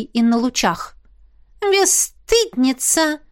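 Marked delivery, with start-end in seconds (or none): 1.6-3.2 snorting. Acted. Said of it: "фыркнула Мисси".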